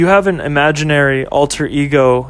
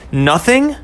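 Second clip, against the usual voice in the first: put-on higher, hurt questioning girlfriend voice; girlfriend Ashley; 6 semitones higher than usual. Girlfriend hurt by boyfriend's words, higher questioning. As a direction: repeat it back, wounded, pitched up.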